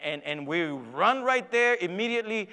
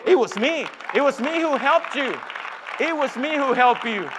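loud, high, ringing declaration; climactic preaching; 8 semitones higher, 9 dB louder than usual. Acted. God telling believer who really helped, thundering preacher's climax. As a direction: proclaim it at full force, repeating for emphasis.

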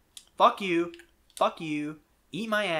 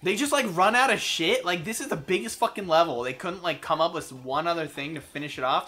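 ordinary talk, nothing marked

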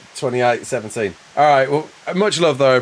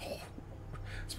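usual speaking voice

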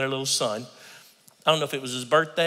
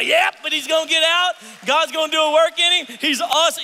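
upbeat insistence; 12 semitones higher, 5 dB louder than usual. Acted. Believer answering back.

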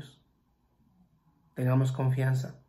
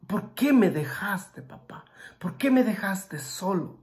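pleading lament; 6 semitones higher than usual. Retold or imitated. Jesus pleading to his father.

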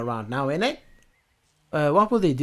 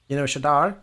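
ordinary delivery

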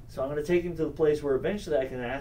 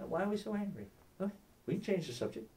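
softer, slightly higher, placating voice; gentle de-escalation; 5 semitones higher, 8 dB quieter, faster than usual. Acted. Apologist to someone hostile and angry, softly placating. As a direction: back off softly and soothe them.

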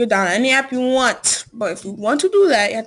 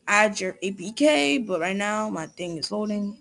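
ordinary talk

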